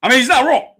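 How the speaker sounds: high, loud, emphatic he's right; excited agreement. Real, not acted. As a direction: hype up the point, stressing not.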